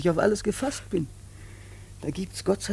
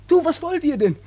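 high, loud furious shouting; furious outburst; 9 semitones higher, 11 dB louder than usual. Acted. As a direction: explode at them in fury. Son erupts at family.

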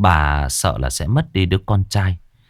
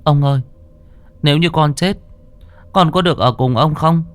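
put-on child's voice; 10 semitones higher, 3 dB louder than usual. A narrator's reading. Boy asking ghost grandpa.

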